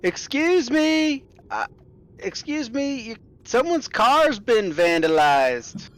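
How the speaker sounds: high-pitched, hammed-up American accent; slow, high parody of an American; bad American accent. English monster impersonates an American at the door, badly faked American accent. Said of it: "in a very American accent".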